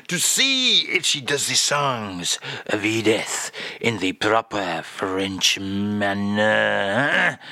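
mock-French accent, slowing to a drawn-out end. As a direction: draw out the last words like a Frenchman.

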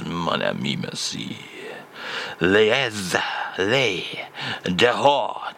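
mock French phrase low and slow; deep French voice. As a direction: growl out mon ami merci slowly. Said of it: "please, Charles".

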